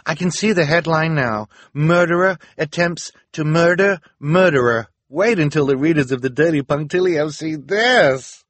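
high, loud, excited proclamation; crying out. A narrator's reading. Reporter picturing the headline for readers, excited cry. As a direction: announce it like a sensational headline. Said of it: "the reporter cried".

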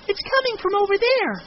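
put-on very high, loud child's cry; excited child. A narrator's reading. Child hearing a car approach, excited. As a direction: pipe up excitedly.

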